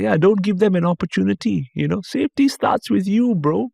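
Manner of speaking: high, breezy driver advice; lightly voiced chill driver. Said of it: "He's like".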